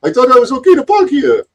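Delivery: high, put-on voice of a confused foreigner; bewildered man imitation. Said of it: "He's like".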